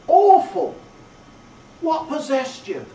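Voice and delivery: a sharp high cry of shock, then dropping back; cried out. A narrator's reading. Joe cries out at Pip's confession, sharp and high. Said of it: "cried Joe".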